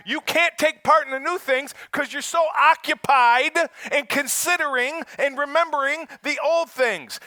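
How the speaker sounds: loud, high, fired-up sermon delivery; preaching at full force. Acted. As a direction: preach it to the back row.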